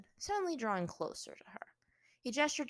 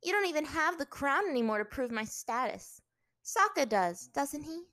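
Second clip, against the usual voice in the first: higher, louder defiant retort; 9 semitones higher, 8 dB louder than usual. A narrator's reading. Captive princess disputing her status, defiant. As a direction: argue back with some scorn.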